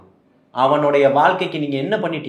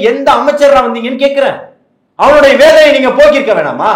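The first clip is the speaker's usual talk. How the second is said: high-pitched, heated appeal, building louder; impassioned rebuke; 6 semitones higher, 4 dB louder than usual. Real, not acted. Party speaker rebukes lawmakers for ignoring workers' pain, impassioned. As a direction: plead their case with indignation.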